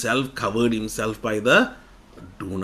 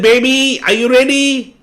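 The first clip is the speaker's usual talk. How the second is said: high, loud sing-song call; mother calling from the doorway, loud and high; 11 semitones higher, 9 dB louder than usual. Acted. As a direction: call out to the hiding child. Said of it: "And mommy said".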